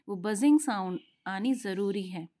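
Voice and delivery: ordinary talk, nothing marked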